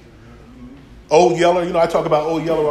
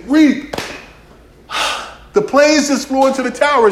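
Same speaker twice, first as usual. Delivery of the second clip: high, loud, panicked report; pitched-up shocked announcement; alarmed news to the dog; 9 semitones higher, 4 dB louder than usual. Retold or imitated. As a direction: burst in with terrible news. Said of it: "I come home and say".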